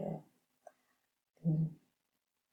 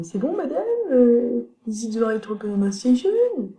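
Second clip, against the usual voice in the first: high, unsure learner asking the teacher, much higher; French student impression; 7 semitones higher, 6 dB louder than usual. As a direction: play a nervous French student checking with madame.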